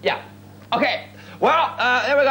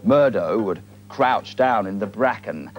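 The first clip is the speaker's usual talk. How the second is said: slow, low storyteller voice drawing out the eerie words; spooky tale narration; 7 semitones lower than usual. A narrator's reading. Host telling viewers an eerie Highland tale, mock-spooky narration. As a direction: spin the yarn like a fireside legend.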